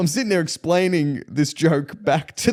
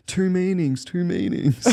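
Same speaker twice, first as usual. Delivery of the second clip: higher, tickled repetition to himself; giggling German gentleman; 6 semitones higher, slower than usual. Retold or imitated. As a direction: lightly imitate an amused older German man. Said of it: "walking off giggling to himself".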